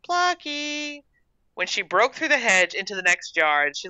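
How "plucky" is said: high, sung-out cat call; still calling softly. A narrator's reading. Gertrude wriggling through bushes after her cat, calling. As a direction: call the name high without raising volume. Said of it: "still calling".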